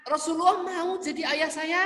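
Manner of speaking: a thrilled orphan hearing he has a father; excited child. Acted. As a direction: gasp out the happy question. Child overjoyed at the offer.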